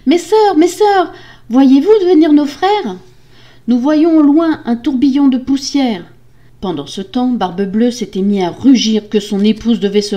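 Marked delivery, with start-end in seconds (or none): 0.1-3.0 high, loud, desperate call. A narrator's reading. Wife calling her sisters, desperate shout. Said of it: "elle criait en direction des remparts". 3.7-6.0 high, loud distant reply; calling back. Acted. Sisters answering the wife, high anxious shout.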